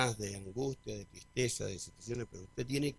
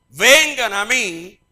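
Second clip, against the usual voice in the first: preached quote of Jesus; 8 semitones higher, 13 dB louder than usual. Acted. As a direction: proclaim it like a revival preacher. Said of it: "¿Qué dijo?".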